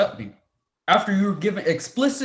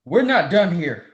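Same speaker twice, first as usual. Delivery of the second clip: louder, firm threat; stern warning; 5 dB louder than usual. Acted. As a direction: cut it off with a warning. Professor ending call sternly.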